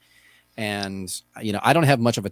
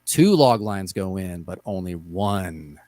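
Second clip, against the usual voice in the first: movie trailer voice; 4 semitones lower, slower than usual. Pretend. Dramatic game rule announcement.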